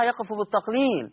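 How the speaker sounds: usual speaking voice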